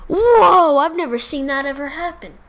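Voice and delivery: loud, high amazed whoa, then excited praise. Retold or imitated. Kid retelling friend's amazement, loud high whoa. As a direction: gasp in loud wonder at the trick.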